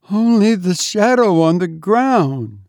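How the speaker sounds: high, awed voice; frightened maid. A narrator's reading. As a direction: answer in dread. Terrified maid answers questions.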